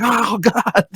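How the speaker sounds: high strained groan of pain; pained oh God. Retold or imitated. Waking with a cramp, high pained oh God. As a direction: squeak it out like a sudden cramp. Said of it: "I'm like".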